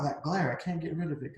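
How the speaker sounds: plain reading voice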